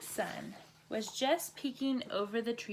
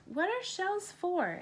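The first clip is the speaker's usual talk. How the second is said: little cub; 10 semitones higher than usual. Acted. Child cub asks father.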